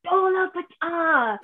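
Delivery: high, slow and drawn out; innocent parroting. Retold or imitated. Child innocently repeats the taught phrase.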